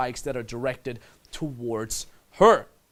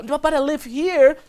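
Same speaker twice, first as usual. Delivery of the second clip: put-on girl qualifying herself; 12 semitones higher, 8 dB louder than usual. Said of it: "I'm like".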